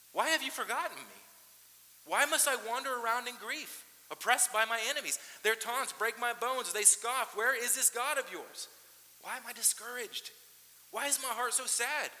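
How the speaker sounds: high, loud, anguished questions; impassioned lament. Acted. Pastor reading the psalmist's cry of abandonment, loud anguish. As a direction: plead with God who seems absent.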